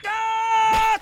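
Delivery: short, high, loud yelp of alarm; startled yelp. Acted. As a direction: jump and yelp. Startled cry. (petit cri aigu et fort de surprise)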